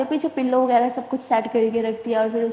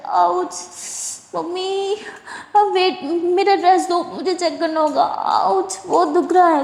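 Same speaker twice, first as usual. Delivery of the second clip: higher whimpering ouches; acted pain; 6 semitones higher than usual. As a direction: wince through each word. Sara in period pain.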